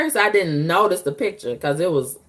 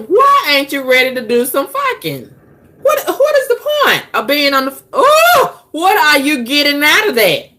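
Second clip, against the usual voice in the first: exasperated rant; 8 semitones higher, 3 dB louder than usual. Real, not acted. Ranting at the teasing man.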